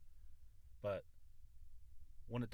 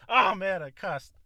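much louder, high, caught-out exclamation; loud dismayed cry; 9 semitones higher, 16 dB louder than usual. Real, not acted. Loud dismay at cussing on the podcast.

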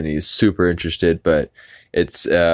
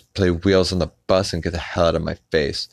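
usual speaking voice

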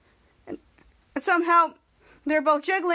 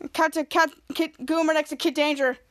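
ordinary delivery, nothing marked

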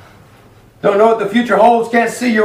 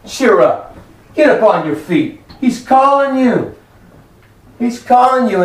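preached exhortation; 5 semitones higher than usual. Acted. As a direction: urge the congregation up, building in pitch. Same words preached to the congregation.

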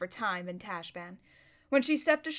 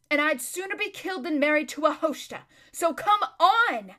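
loud, impatient retort building to an order; defiant; 5 semitones higher, 7 dB louder than usual. Acted. Erebus refusing marriage and hurrying her friend, loud and defiant. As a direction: drag out the final order in exasperation.

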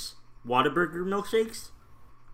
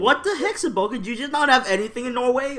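mock outrage; 7 semitones higher than usual.

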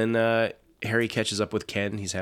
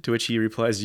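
ordinary delivery

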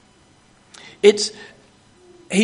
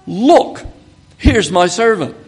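loud, higher "Look, here's my servant"; emphatic announcement; 4 semitones higher, 6 dB louder than usual. Acted. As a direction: announce it with a proud flourish. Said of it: "It's that emphatic".

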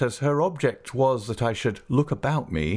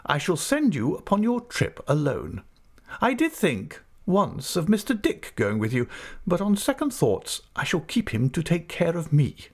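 brisk, much higher voice of a stern aunt; 8 semitones higher than usual. Acted. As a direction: play the decisive old aunt. Aunt speaks, voiced high.

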